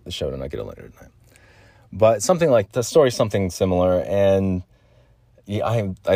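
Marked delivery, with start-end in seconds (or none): 0.0-1.1 rushed, much softer aside; fast quiet mutter. Real, not acted. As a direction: hurry through it under your breath. Repeats phrase quickly and quietly.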